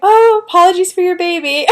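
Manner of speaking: high-pitched retold dismay; shocked. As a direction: imitate her mortified repeat. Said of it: "she was so shocked 'cause she's like".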